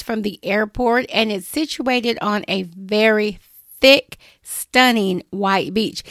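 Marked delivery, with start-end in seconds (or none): 3.8-6.0 "thick" drawn out and emphasized, slow; stress on thick. Real, not acted. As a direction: linger on "thick". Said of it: "I emphasize the word thick".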